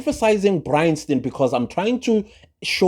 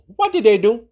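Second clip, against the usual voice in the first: high, rising mock-curious ask; higher rhetorical question, rising at the end; 9 semitones higher, 4 dB louder than usual. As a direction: toss the question up to the viewers.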